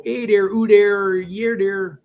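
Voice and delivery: put-on playful caricature of a Fargo accent, slowed; mock Fargo accent. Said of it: "Fargo does not go".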